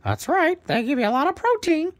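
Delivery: high, loud squawky parrot; proud parrot introducing himself, high and loud. Acted. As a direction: chirp it out as a cheerful parrot bird. Parrot agrees.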